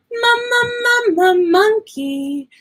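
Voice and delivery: high bouncy "ma, ma, ma" rising into "monkey"; sounding out M. Shown as a demonstration. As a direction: bounce the M sound up for little kids. Teacher sounding out letter.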